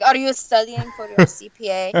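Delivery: light imitation of a parent's Persian accent. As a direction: lightly voice a Persian mom or dad asking about the exam. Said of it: "when you're doing your parents".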